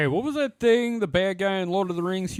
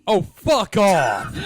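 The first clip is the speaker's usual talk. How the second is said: slow, drawn-out loud groan of disbelief; exasperated shout; 6 dB louder, much slower than usual. Real, not acted. Reacting to the MCP acronym with a slow, fed-up shout.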